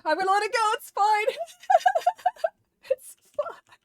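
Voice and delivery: high, loud, teary laugh-cry; laughing through tears. Real, not acted. Streamer mourns her lost pony, laughing through tears. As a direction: brave it out while half crying.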